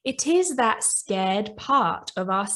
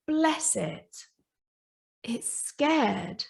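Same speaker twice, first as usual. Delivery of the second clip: tender pity; 6 dB quieter, much slower than usual. Real, not acted. Speaker cooing tenderly over her frightened ego. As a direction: coo over the scared ego like a small pet.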